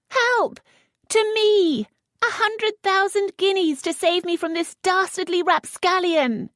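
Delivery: very high, loud shout; high scream for help. Acted. Lisette screaming for rescue, very high and loud. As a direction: scream it through the door.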